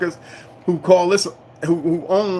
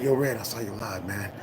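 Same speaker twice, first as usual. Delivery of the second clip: softer quoting a caller on the phone; hushed friend; 5 semitones lower, 9 dB quieter than usual. Retold or imitated. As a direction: pass on the praise lightly and quietly. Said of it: "and they be like".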